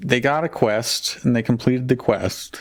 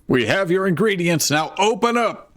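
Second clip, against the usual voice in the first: yelling; 9 semitones higher than usual. Acted. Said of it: "yelled Johnny Vigilant".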